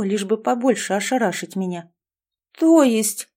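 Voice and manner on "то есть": sharp, high, loud "то есть?"; stunned. A narrator's reading. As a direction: blurt it out, thrown. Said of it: "обалдела я". (ошарашенно, громко)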